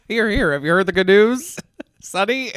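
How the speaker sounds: hammed-up old-fashioned preacher; old-timey voice. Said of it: "What, what era are we in?".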